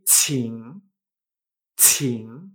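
"qing" with a strong puff, dipping then rising; aspirated Mandarin "qing", rising at the end; explosion of air. Shown as a demonstration. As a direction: burst air into a low "qing". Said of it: "se nota esa explosión de aire".

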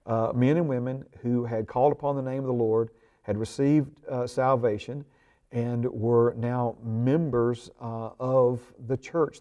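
ordinary delivery

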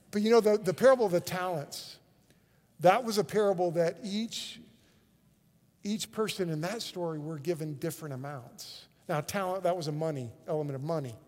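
ordinary talk, nothing marked

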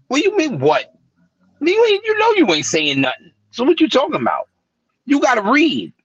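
imitating an exasperated uncle, voice jumping high; high-pitched incredulous telling-off, acted; mock scolding uncle. Uncle challenging his nephew's empty rhymes, high exasperated scolding.

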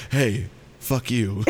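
same words, different angle. Retold or imitated. Guy looking down from a different angle, slow low mock delivery. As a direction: drop it down and draw it out, half laughing. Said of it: "looking down at you from a different angle going".

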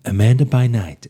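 loud, overblown first take; too loud. Shown as a demonstration. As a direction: blast the opening line. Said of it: "gonna take that again".

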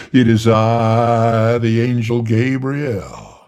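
loud, slow, grand voice from inside; booming angel voice. Acted. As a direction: boom it like a heavenly being. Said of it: "Jock playing Gabriel".

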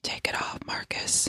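breathy flirty whisper. Pretend. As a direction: whisper it seductively.

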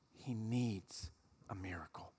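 a soft, unvoiced whisper of desperation; whispered plea. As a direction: whisper it close, as a last hope. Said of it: "and he whispers".

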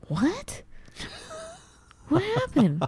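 high, confused questioning; baffled high voice. Retold or imitated. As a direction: sound lost, asking high. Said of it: "I can hear myself".

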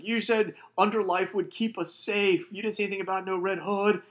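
high-pitched, agitated complaint; mock panicky accusation; acted alarmed protest. Acts frightened sheriff protesting by radio.

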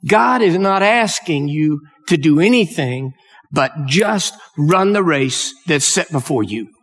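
usual speaking voice

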